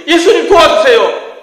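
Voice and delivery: high, loud, strained plea; desperate cry. Shown as a demonstration. Pastor's shortest prayer, yelled high. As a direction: yell it like you're crashing. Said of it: "이것도 너무 길어".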